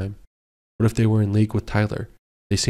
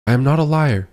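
higher, louder denial; hollering; 5 semitones higher, 4 dB louder than usual. Acted. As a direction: holler it back at him. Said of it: "she hollered at him".

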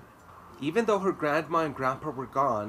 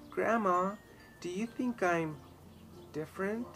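lightly voiced small girl, a touch higher; a hint of a little girl; 8 semitones higher than usual. A narrator's reading. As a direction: ask grandma like a shy little girl. Little girl asking grandma.

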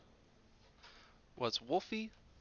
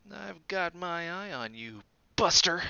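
suspicious warning; 7 semitones higher, 4 dB louder than usual. A narrator's reading. Dot threatens Wolfie.

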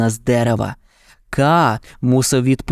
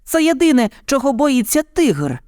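high-pitched excited bird voice; vultures crying out; 12 semitones higher than usual. A narrator's reading. Vultures tell what the tiger fears, high cry. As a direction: pipe it up high like birds. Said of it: "закричали грифи".

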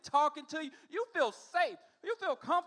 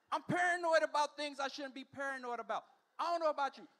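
ordinary talk

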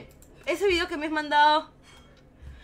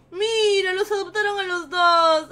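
high, loud, tearful relief; drawn-out cry of being deeply moved; emocionada, casi llorando; 5 semitones higher, 7 dB louder, slower than usual. Real, not acted. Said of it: "ya me estaba poniendo a llorar". (grito conmovido, al borde del llanto)

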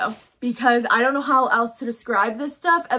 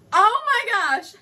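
excited gasp; 8 semitones higher, slower than usual. Real, not acted. Unboxing a favorite jacket.

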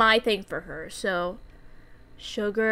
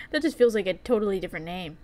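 usual speaking voice

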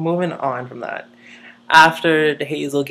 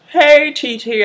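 high, loud mock hail; loud, high "hey" to get attention; calling out; 11 semitones higher, 9 dB louder, slower than usual. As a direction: imitate calling a friend across the party. Hailing someone across a party.

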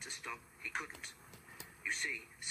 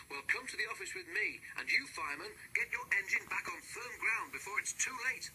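high, commanding foreman voice; foreman ordering; 9 semitones higher than usual. A narrator's reading. Foreman ordering the driver and fireman, high and commanding. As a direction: bark out orders in a hurry. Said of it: "ordered the foreman".

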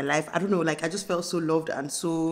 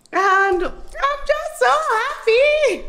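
very high, slow, stretched happy squeal; screaming with joy; 4 dB louder, much slower than usual. Real, not acted. Telling her viewers she is overjoyed, a drawn-out high squeal. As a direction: let the joy burst out of you. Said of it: "I think I'm screaming at you guys".

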